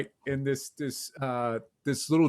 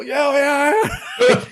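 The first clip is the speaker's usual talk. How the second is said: crowd cheering; 10 semitones higher, 8 dB louder, much slower than usual. Retold or imitated.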